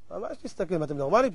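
low, soft, baffled reply; puzzled muttering. Acted. Tourist to townsfolk who scolded him, baffled mutter. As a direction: drop right down to a low murmur.

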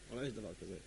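very low, soft aside; deep, quiet, subdued response; hushed reply. Acted. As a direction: answer the wailing in a low murmur. Rabbi's reply after the wail.

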